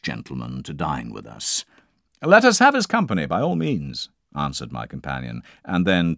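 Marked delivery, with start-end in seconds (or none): 2.2-4.1 much higher and louder, eager; eager agreement. Acted. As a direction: leap at the idea, fast and bright.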